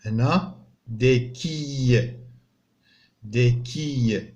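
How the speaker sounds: des quilles said very slowly and clearly; teacher modelling French; slow model word. Shown as a demonstration. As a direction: sound out des quilles for pupils. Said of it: "teacher models French words".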